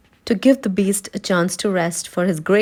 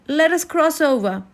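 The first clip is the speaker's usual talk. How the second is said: higher, hurried plea; urgent Scarecrow; 8 semitones higher than usual. A narrator's reading. Scarecrow urging friends to cross, higher and urgent. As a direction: push them to move now.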